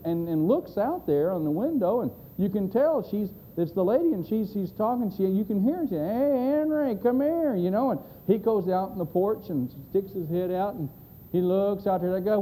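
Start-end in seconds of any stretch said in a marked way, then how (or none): 6.0-7.6 imitated wife's high holler; calling out. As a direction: summon someone from another room, high-pitched. Lady calls husband to window.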